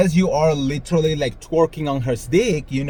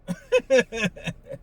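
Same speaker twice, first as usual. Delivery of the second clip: laughing; 9 semitones higher than usual. Real, not acted. Laughs at own fantasy.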